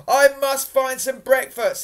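high, loud declaration; eager resolve. A narrator's reading. Hungry Jack deciding to find breakfast, declaring it loudly. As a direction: announce the thought with big energy. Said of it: "he thought".

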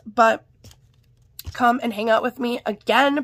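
normal talking voice

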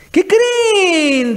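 high, drawn-out teasing question; mock announcement. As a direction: tease out big news as the governors. Voicing governors' boastful announcement.

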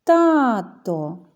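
loud call with long TA in «Тато». Shown as a demonstration. Teacher shows children the stress, «Тато» called out. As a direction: call out «Тато», stretching the stressed first syllable. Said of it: "неначе гукаємо".

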